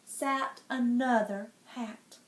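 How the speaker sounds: plain reading voice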